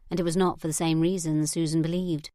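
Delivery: ordinary delivery, nothing marked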